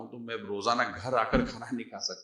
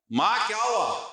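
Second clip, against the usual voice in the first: higher, louder, slow worried cry of ma; a son bursting in, alarmed; alarmed call; 9 semitones higher, 4 dB louder, slower than usual. Retold or imitated. Eldest son finding mother crying, alarmed high call.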